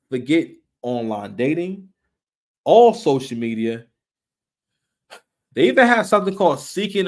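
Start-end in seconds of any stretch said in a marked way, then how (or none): none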